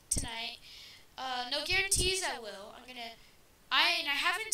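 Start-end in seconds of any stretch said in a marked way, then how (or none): none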